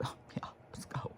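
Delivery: breathy whisper for the dog's reply. Pretend. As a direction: whisper it as the eager dog. Dog's imagined reply voiced.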